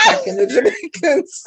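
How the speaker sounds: mock outrage breaking into laughter; teasing the host, cracking up; laughing accusation. Real, not acted. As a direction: laugh through the joking charge.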